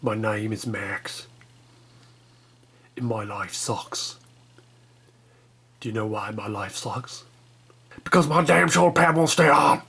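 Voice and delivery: a self-pitying Australian Max, badly done; bad Australian accent. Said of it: "My Australian accent really sucks".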